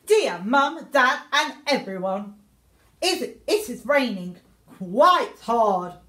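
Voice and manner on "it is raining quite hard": usual speaking voice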